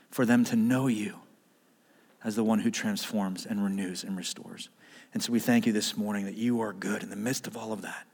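soft, low prayer voice. Real, not acted. Pastor closing a prayer with the congregation, soft and low. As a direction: pray gently, sinking to a hushed amen.

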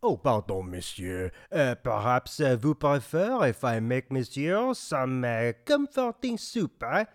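hammed-up French waiter, rising eh on every line; thick mock French accent, eh after each line; comic French accent. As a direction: play the offended French waiter.

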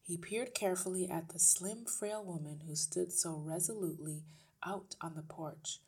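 ordinary delivery, nothing marked

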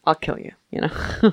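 ordinary talk

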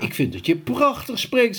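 put-on a little girl speaking sweetly; high girl's voice. A narrator's reading. Reading to listeners, Sophie speaks high and sweet. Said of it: "zei Sophie".

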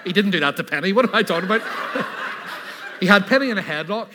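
giggling as he tells it; laughing. Real, not acted. Laughs through his own joke.

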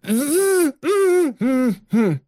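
high, stuttering panic; panicked stammer. Acted. Buzzy panicking because his voice would give him away. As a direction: stammer while scrambling for words. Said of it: "panicking and tries to think".

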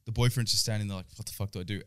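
usual speaking voice

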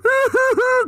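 very high mock sobs; hammed-up high boo-hoo noise; imitated crying. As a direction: fake a high-pitched crying fit. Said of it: "crying on my back".

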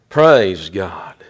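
normal talking voice

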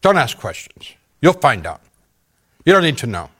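rising to a shouted "Move it"; rude orders. Retold or imitated. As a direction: bark orders at a captive. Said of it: "become more rude".

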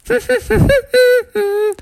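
high tittering cartoon laugh; mocking fairy laugh. Tinkerbell mocking Froggy's wish to fly, high teasing titter. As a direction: titter high to taunt him. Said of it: "mocked at him and said".

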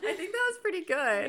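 very high, squeaky laugh-talk. Real, not acted. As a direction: squeeze words out through laughter. Laughing after failed accent.

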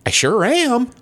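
high put-on comic voice; Joe Mackey impression. Imitating Joe Mackey answering, high put-on voice. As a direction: pitch it way up, proud. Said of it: "I just love doing his voice".

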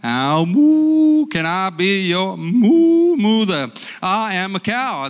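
loud, slow, mooing cow stretching out moo. Acted. Grandpa voicing the cow answering the baby bird, loud mooing. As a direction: bellow it out like a big cow. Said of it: "he said to the cow".

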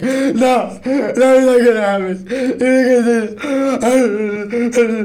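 high, loud mock child's wail; upset little girl. Retelling a preschool girl wailing, high and loud. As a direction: squeal it like a panicked kid refusing. Scared girl screaming over balloons.

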